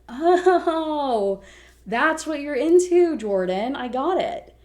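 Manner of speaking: high, loud, drawn-out gotcha; mocking taunt. Abusive ex catching her with another man, mocking. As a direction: act out a slow, jeering realization.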